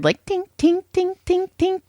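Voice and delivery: high, pinging table noise imitation; weird tinking noise. Imitating the broken traction bed, high metallic tinks. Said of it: "it was making this weird".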